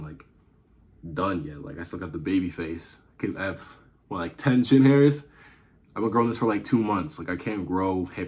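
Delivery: ordinary delivery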